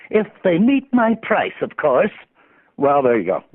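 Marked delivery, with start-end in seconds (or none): 0.1-2.1 higher, slow cocky cartoon duck hero; Darkwing Duck voice. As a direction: play the vain duck hero angling for a fee. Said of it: "Thank you, D W".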